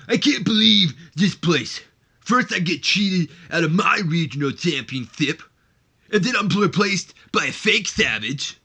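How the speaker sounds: loud, high, ranting wrestler promo voice; ranting heel wrestler promo, loud and high; Ripper Savage voice. Acted. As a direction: cut an angry promo, yelling. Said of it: "in that Ripper Savage voice that we all love".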